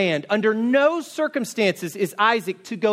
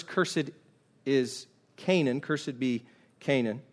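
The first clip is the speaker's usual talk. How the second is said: hushed solemn curse; 7 semitones lower, 7 dB quieter than usual. Acted. Noah speaks this curse.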